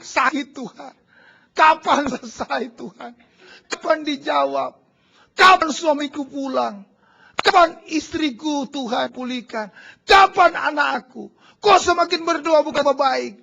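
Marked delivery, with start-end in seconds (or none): 0.0-11.3 slow, pleading cries growing louder; crying out to God. Retold or imitated. Suffering believer crying out to God, slow pleading shout. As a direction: cry out in anguish, rising to a shout.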